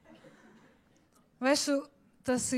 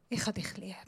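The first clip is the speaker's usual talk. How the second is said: tender hush; 4 semitones lower, 9 dB quieter, faster than usual. Shown as a demonstration. God's loving words acted out.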